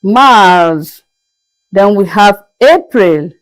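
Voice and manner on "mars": Mars as a long "mahs"; French pronunciation. Shown as a demonstration. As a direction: say Mars the French way, open drawn vowel. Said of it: "is pronounced as Mars".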